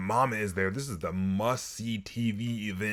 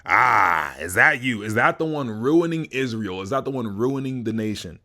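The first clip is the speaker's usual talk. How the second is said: smack talk; 8 dB louder than usual. Retold or imitated. Ahab smack talking Elijah.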